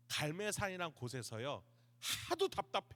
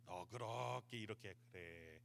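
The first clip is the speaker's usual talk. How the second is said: God's imagined reply; 11 semitones lower, 11 dB quieter than usual. Acted. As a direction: answer low and quiet, stretching the words. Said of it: "하나님 어떠셨을까요?".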